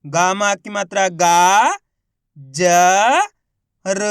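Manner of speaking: slow spelling with a long गा; isolated sounds with pauses between; letter by letter. Shown as a demonstration. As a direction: hold गा, then ज and र apart. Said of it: "किस तरीके से इनका उच्चारण करके".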